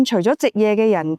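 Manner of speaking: ordinary delivery, nothing marked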